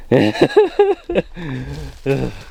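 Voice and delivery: real run of nervous chuckles; nervous laugh. Real, not acted. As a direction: laugh off your nerves. Nervous about going down.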